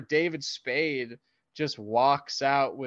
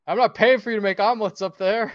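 loud, fast scolding dad; mock angry dad; 7 semitones higher, 8 dB louder, faster than usual. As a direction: scold like a fed-up father. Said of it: "the dad goes like".

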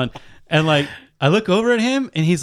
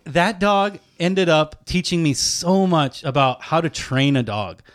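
ordinary talk

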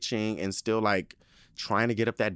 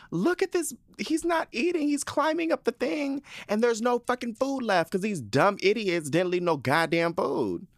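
high-pitched, outraged mock screaming; retold screaming rant; 13 semitones higher than usual. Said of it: "I was screaming in my house".

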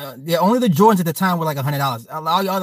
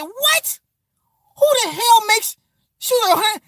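very high-pitched mock outrage; squawked disbelief, hammed up; incredulous mother. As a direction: imitate mom aghast at the price. Mother shocked at shoe price.

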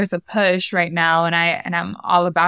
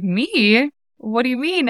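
surprised, taken aback; 6 semitones higher than usual. Retold or imitated.

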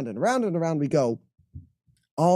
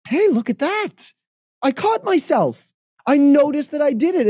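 high, pleased self-congratulation; proud of oneself; 11 semitones higher, 5 dB louder than usual. Acted. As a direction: celebrate catching yourself in the act. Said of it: "genuinely be proud of yourself".

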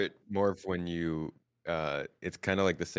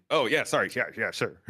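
higher, louder flustered backpedal; playing a stunned local recovering; 5 semitones higher, 4 dB louder than usual. A Turkish local startled by an American speaking Turkish, flustered.

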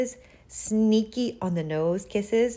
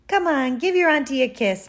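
higher, louder coaxing aunt; coaxing purr; 7 semitones higher, 5 dB louder, faster than usual. A narrator's reading. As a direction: wheedle a kiss from a nephew. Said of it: "purred Aunt Tallulah".

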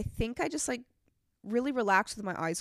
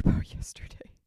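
whispering; 6 dB louder than usual. Real, not acted. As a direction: giggly whisper. Laughing whisper aside.

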